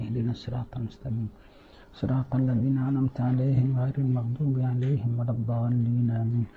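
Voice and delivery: soft, fast murmured Fatiha; quiet recitation. Acted. Congregation reciting the Fatiha together, murmured softly. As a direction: murmur it under your breath.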